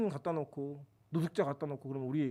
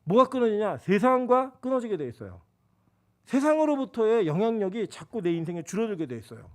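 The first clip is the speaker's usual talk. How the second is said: loud, high preaching; 3 semitones higher, 5 dB louder than usual. Acted. Pastor to congregation, full-force preaching. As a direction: press the point to the congregation.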